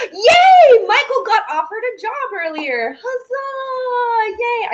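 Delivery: high, whooping celebration; excited cheer. Real, not acted. Host celebrating a viewer's job offer, excited cheer. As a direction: cheer the good news with drawn-out whoops.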